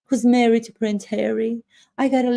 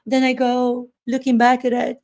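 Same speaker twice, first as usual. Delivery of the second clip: ordinary talk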